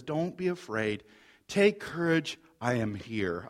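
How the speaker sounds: soft, low reassurance; calm their fear quietly, voice dropped; speaking peace. Retold or imitated. Jesus to his fearful disciples, speaking peace softly. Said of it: "Jesus speaks peace over their fearful hearts".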